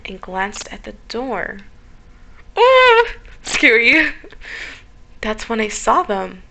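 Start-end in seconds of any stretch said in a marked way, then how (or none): 2.6-4.1 high, loud frightened yelp; scared horror game streamer. Real, not acted. Sees something at the door.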